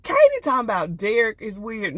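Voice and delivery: normal talking voice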